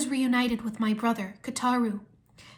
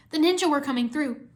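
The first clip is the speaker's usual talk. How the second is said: ordinary delivery, nothing marked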